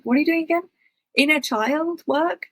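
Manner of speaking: puzzled, high rising query; baffled questioning. Retold or imitated. Acting out her bafflement at friends.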